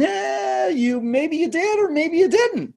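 high, wondering voice of an unsure listener; doubtful listener. Retold or imitated. Coach voicing a confused audience, high and unsure. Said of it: "leaves audiences wondering".

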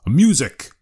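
sharp loud high order; command. A narrator's reading. Harrison ordering the musicians, a loud command. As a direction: command the band. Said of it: "he commanded".

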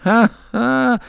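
high laugh; amused high-pitched laugh; old man's cackle. A narrator's reading. Agent laughs at the question.

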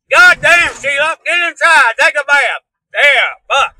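loud, high hillbilly holler; yelling at sister. Acted. Hillbilly brother mistook sister for Sasquatch, hollering at her. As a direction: holler orders across the yard.

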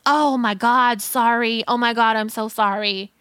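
ordinary talk, nothing marked

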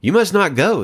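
pleading crowd. Acted. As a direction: beg the king not to go. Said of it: "the people pleaded".

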